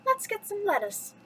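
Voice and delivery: high, sing-out storybook heroine; Belle talking to herself. A narrator's reading. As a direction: announce it to yourself in a high voice. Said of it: "she said out loud".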